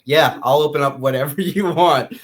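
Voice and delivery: eager yes-man voice, breaking into a laugh; laughing mock eager official. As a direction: play the leader overly keen, laughing as you go. Quoting eager Bahamas leader.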